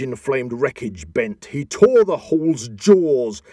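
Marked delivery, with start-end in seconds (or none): 1.5-3.4 louder, higher and slow, tearing into each word; dramatic booming narration. A narrator's reading. Narrator thundering Grendel's attack on the hall, slow and loud. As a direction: let the violence ring out slowly.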